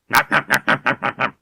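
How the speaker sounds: loud, high, rapid munching sounds; cartoonish gobbling; greedy chomping noise. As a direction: chomp it out in even bursts. Game master imitates pet devouring rat.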